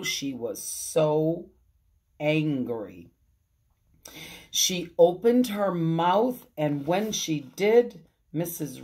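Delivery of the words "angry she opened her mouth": usual speaking voice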